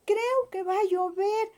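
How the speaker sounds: a rabbit fretting at the clouds; worried little rabbit. Acted. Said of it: "y dijo". (conejito preocupado, voz aguda)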